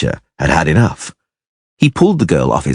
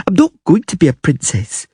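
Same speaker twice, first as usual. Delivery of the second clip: put-on muttering child; 11 semitones higher than usual. A narrator's reading. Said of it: "she muttered".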